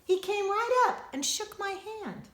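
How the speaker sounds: plain reading voice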